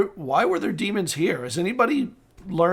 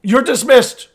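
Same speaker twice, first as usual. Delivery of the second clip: loud, higher command; curt dismissal; 5 semitones higher, 6 dB louder than usual. Acted. Lord dismissing the steward, curt and commanding. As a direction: send the servant out sharply.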